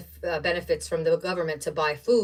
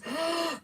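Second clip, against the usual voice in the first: delighted gasp; 10 semitones higher, 6 dB quieter than usual. Real, not acted. Seeing tiny Christmas cutouts.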